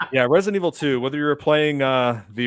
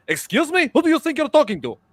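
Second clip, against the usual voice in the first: put-on high-pitched indignant Russian-accented outburst; very angry; 13 semitones higher, 4 dB louder than usual. Prank caller answering a cussing customer, very angry. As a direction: snap back, much higher, in a fake Russian accent. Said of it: "very angry. I'm like".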